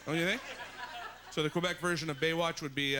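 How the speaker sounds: usual speaking voice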